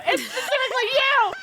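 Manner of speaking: high, wailing, half-laughing tirade; retelling a meltdown at a friend; mock freak-out. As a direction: act out the unfair blame. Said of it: "I freaked out at one of my roleplay friends".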